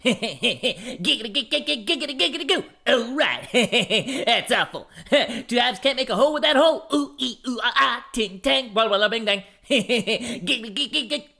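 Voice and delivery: rapid stuttering giggles, pitch climbing; lecherous cartoon catchphrase voice. Showing off an impression, gleeful lecherous cartoon voice. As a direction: chuckle and rattle it out with sleazy glee.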